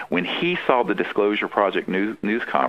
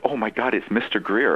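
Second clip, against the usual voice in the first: ordinary talk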